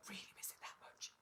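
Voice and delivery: whispered teasing aside. Real, not acted.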